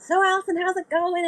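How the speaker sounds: high, bright mock greeting of an old friend; John's greeting. Friend John greets her.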